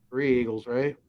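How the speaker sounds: ordinary talk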